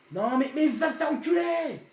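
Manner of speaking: furious slow shout; raging gamer. Real, not acted. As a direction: yell the insult at the game. (joueur en rage qui hurle)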